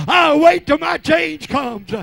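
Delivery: high, loud and drawn out; shouted vow. Real, not acted. Preacher vowing to wait on the Lord, shouted. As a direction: cry the promise to the rafters.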